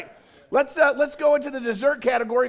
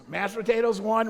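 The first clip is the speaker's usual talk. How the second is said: ordinary talk, nothing marked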